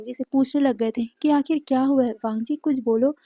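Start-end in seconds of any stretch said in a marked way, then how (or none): none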